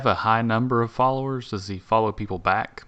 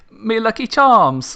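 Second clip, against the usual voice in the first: hammed-up Irish, much higher, slow, laughing; mock Irish accent; 11 semitones higher, 6 dB louder, slower than usual. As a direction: leprechaun Irish, high and laughing through it. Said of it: "when I want to do Irish".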